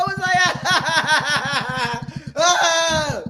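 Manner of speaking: big burst of helpless laughter, rising high; uncontrollable laughter. Retold or imitated. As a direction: laugh like you can't stop, ending on an ah.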